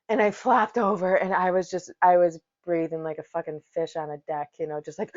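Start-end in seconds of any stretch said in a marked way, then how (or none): none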